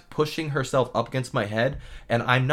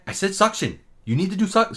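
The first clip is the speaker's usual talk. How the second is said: higher, imitated snippy retort; 6 semitones higher than usual. Dentist repeats her order to the assistant, snippy. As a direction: snip back at a colleague. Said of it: "she's, like, snipping".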